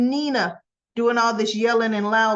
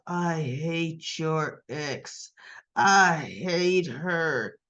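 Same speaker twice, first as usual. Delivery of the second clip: cold hatred; 6 semitones lower, much slower than usual. Retold or imitated. Acting Nina's hatred.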